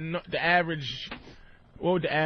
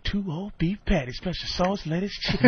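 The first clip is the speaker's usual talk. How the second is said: a lightly voiced jazzy crooner. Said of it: "make it jazzy".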